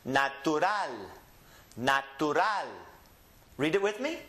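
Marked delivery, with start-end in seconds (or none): 0.1-2.7 natural in Spanish, na-tu-RAL; Spanish end stress. Shown as a demonstration. Teacher demonstrates words ending in L, natural stressed on RAL. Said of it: "the last syllable gets emphasized".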